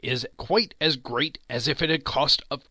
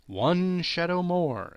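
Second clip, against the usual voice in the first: exclaimed; 6 semitones higher, slower than usual. A narrator's reading. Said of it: "exclaimed the ghost".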